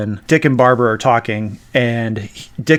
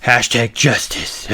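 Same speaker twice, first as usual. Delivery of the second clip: mock Batman; slower than usual. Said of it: "Batman doing just, like".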